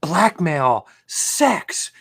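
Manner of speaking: ordinary talk, nothing marked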